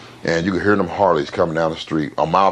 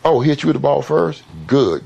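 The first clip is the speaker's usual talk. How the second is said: much higher, loud sudden approval; dad's approving surprise; 8 semitones higher, 3 dB louder than usual. Retold or imitated. Biker dad to son after the fight, pleased surprised outburst. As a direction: flip from anger to proud delight. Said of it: "my dad said".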